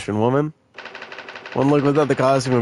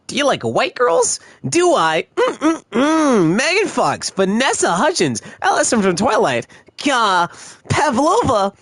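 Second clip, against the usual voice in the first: high, hammed-up quoting voice; acted-out tweet; 12 semitones higher, 5 dB louder than usual. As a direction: act out the tweet, pitched up and swooping. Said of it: "that one Shameik Moore tweet".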